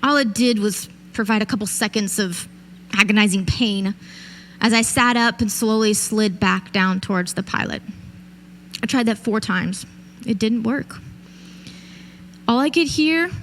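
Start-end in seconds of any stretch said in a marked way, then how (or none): none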